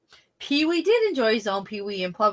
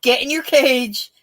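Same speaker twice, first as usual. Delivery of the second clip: sharp, higher, louder command from the skit; bossy Debbie Manopoulos; 6 semitones higher, 6 dB louder than usual. Retold or imitated. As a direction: bark the order at the girl, lightly mocking. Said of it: "Debbie Manopoulos".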